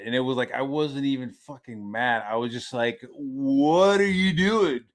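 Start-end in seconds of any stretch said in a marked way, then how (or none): 3.1-4.8 drawn-out, incredulous question, pitch lifting; baffled disbelief. Retold or imitated. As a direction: stretch it out in sheer bafflement. Baffled parent retelling kids' muddy antics.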